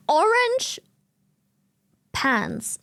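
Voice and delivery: ordinary talk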